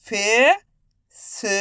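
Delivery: Facebook said slowly, syllable by syllable; slow syllable reading. Shown as a demonstration. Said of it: "अब चलिए आगे देखिए". (धीरे धीरे फेसबुक)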